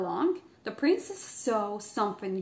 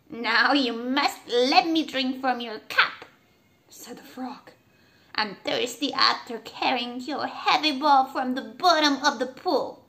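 louder frog, insisting; demanding frog, pleased with himself; 3 semitones higher, 5 dB louder than usual. A narrator's reading. Frog asks to drink from the princess's cup.

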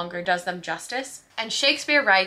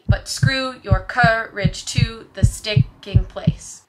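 slow, loud iambic pulse on "screw", "cour", "to", "stick", "place"; even, pounding scansion splitting "courage" and "sticking"; heartbeat rhythm; 6 dB louder, much slower than usual. Shown as a demonstration. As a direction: thump out every second syllable like a heartbeat. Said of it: "iambic pentameter sounds like a heartbeat".

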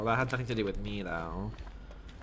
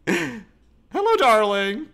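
high, louder mock "Hello, darling"; hammed-up creepy greeting; 9 semitones higher, 7 dB louder, much slower than usual. Gamer greeting a stranger in game, hammed-up creepy. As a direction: croon it like a creep.

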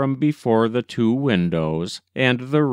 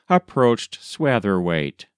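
usual speaking voice

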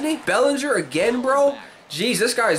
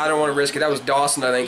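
normal talking voice

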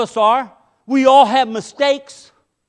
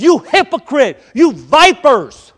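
loud, higher, vipers dragged out slowly; angry rebuke; 3 semitones higher, 8 dB louder than usual. Acted. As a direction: thunder it at the Pharisees. Jesus rebuking the Pharisees.

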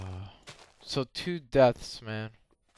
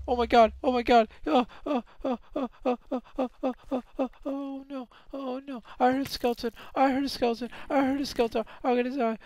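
high, rapid frightened babble; real panic, high-pitched; panicky; 11 semitones higher, faster than usual. Real, not acted. Panicking, hears a skeleton.

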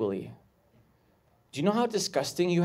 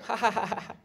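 higher imitated "ha ha" laughter; mock crowd laugh; 7 semitones higher, 4 dB quieter, faster than usual. Comedian imitating a laughing crowd, mock laugh. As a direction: fake an audience laughing. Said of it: "jokes with the crowd going".

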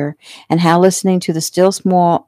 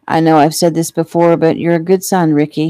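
plain reading voice

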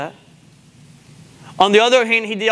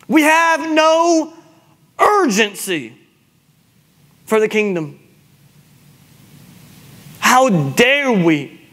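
urgent, raised exhortation with long pauses; impassioned rebuke from the pulpit; full-force preaching; 7 semitones higher than usual. Real, not acted. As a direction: press the congregation hard, stretching key words.

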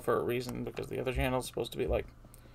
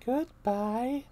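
high and rising goodbye; high goodbye with a rise; 12 semitones higher, 4 dB louder than usual. Acted. Peach says goodbye again, rising at the end. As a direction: lift the end up.

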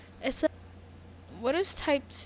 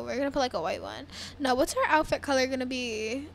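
ordinary talk, nothing marked

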